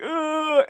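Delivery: high strained groan of back pain. Retold or imitated. Retelling his pinched back, a high pained groan. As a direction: groan like a nerve just pinched. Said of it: "I was like".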